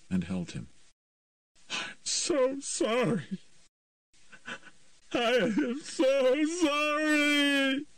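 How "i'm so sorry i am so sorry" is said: very slow, high, broken apology; boy sobbing his apology into her arms. A narrator's reading. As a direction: sob the words out. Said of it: "started sobbing".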